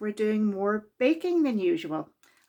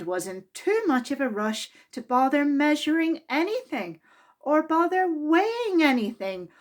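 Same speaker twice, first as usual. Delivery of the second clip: lively, expressive read-aloud voice; children's story reading; 4 semitones higher than usual. A narrator's reading. Narrator reading a Mister Men story to children, lively and expressive. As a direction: read it to a room of small children. Reads picture book aloud.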